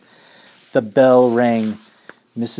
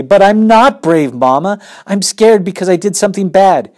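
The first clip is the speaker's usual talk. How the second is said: put-on scared child; 9 semitones higher, 9 dB louder than usual. Scared child confessing to mother.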